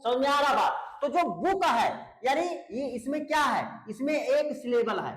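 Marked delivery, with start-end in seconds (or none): none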